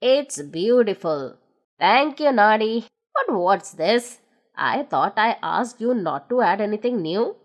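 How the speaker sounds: put-on low, older gnome voice; a low, fond old friend; Big Ears thanking Noddy. A narrator's reading.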